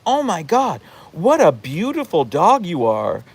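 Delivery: high swooping dog-praise voice; exaggerated gushing. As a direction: fawn over a strange dog for show. Showily praising a strange dog.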